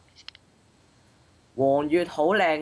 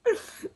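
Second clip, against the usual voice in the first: high short laugh. Real, not acted. Wrong guess.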